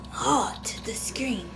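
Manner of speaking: breathy whispered request. Real, not acted. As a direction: whisper it to the viewers. Asks viewers to heart screen.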